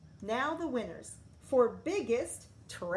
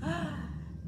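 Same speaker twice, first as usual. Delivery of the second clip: high quick gasp; dramatic gasp; 7 semitones higher than usual. A narrator's reading. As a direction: gasp in mock shock. After dramatic sting.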